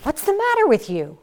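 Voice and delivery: loud rebuke. Shown as a demonstration. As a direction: bark it out as a demo. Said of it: "I could say that".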